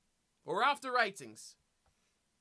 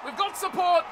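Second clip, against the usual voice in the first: high, loud excited repeat; urgent shout; 7 semitones higher, 8 dB louder than usual. Real, not acted. Gamer urging on the attack in the game, urgent shout. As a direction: call it out urgently to the runner.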